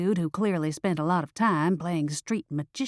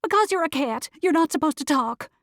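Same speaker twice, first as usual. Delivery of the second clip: high-pitched, panicked protest; screaming back; 9 semitones higher than usual. A narrator's reading. Driver protests to the talking cat, high alarmed cry.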